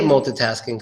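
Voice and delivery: "multitasking" as mul-tuh-tasking; TUH variant. Shown as a demonstration. Teacher gives example sentence, multitasking with TUH. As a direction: say "multitasking" with a weak middle vowel. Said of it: "many different ways to say".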